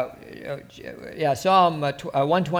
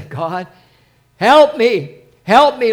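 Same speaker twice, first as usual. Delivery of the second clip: loud pleading cry for help; desperate loud prayer; crying out to God; 5 semitones higher, 10 dB louder, slower than usual. Shown as a demonstration. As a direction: beg God out loud, voice lifted. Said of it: "Like, cry out".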